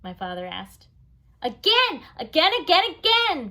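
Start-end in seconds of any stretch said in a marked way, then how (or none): 1.4-3.5 much higher, loud repeated begging; eager toddler. Acted. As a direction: bounce on each again. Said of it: "Fudge said".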